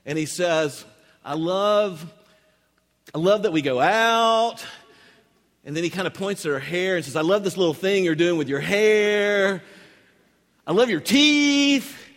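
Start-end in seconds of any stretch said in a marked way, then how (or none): 1.2-4.6 halting start, then louder, higher grasping; mock befuddled. Preacher imitates boyfriend put on the spot, befuddled. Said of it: "he is just absolutely befuddled". 7.2-12.0 high, loud, stretched-out desperate compliments; mock befuddled. Preacher imitates befuddled boyfriend praising hair and teeth, grasping loudly. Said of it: "he is just absolutely befuddled".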